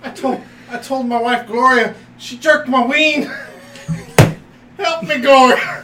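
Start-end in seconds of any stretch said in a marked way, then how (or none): 4.8-5.8 loud, slow, higher mock cry for help; mock plea. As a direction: wail it to the wife, hammed-up. Begging wife in retold tale.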